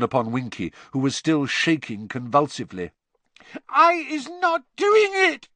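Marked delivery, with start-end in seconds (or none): 3.7-5.5 very high, loud elf squeal; frantic little creature's piercing protest; terrified squeal. A narrator's reading. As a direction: squeal it slowly in terror. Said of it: "she squealed".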